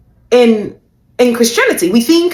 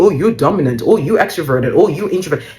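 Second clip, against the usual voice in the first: lower, sing-song dismissive listing; mocking quiz results; 6 semitones lower than usual. Coach parroting fancy personality quizzes to listeners, mocking. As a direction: reel them off as a dropped-pitch parody.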